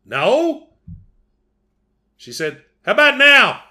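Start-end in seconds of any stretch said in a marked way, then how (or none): 0.0-0.7 high, louder questioning answer; puzzled reply. Retold or imitated. Husband answering wife's odd question, high puzzled call. 2.8-3.6 high, loud mock yell; hollered punchline. Wife testing the voodoo doll, high loud holler.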